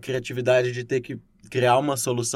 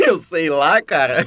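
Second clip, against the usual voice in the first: laughing; 7 dB louder, much slower than usual. Real, not acted. Laughing at a silly question.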